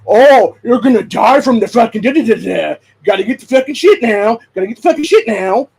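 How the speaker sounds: loud, high imitation of nagging relatives; mocking nag. Aunts pestering his mom about the shot, loud mocking nag. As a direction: hammer it out as a mock panicky harangue.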